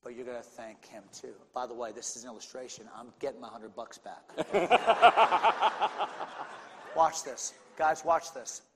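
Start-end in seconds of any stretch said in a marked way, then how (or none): 4.4-6.6 short laugh after his own joke; real laugh. Real, not acted. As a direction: chuckle at your own joke. Laughs after joking about getting money back.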